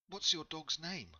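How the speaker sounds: polite traveller explaining herself; lightly voiced Ingrid.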